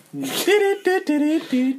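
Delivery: mock trumpet ditty. Real, not acted. Imitating sitcom ending jingle.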